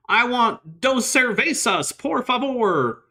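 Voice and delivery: high, drawn-out, bad Mexican accent; hammed-up fake Mexican accent of a clueless restaurant customer. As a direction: overdo a phony Mexican accent, slowly and high. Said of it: "his fake Mexican accent".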